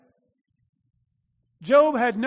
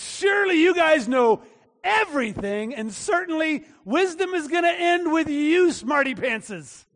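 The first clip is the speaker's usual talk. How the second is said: sarcastic mock admiration, pitched up then dragged out; heavy sarcasm; 5 semitones higher than usual. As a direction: jeer at the know-it-all friends. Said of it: "with a heavy dose of sarcasm".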